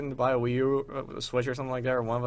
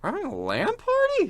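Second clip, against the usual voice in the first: pitched way up in real surprise; surprised question. Real, not acted. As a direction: genuinely caught off guard, high and rising. Surprised at news of party.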